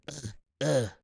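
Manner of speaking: usual speaking voice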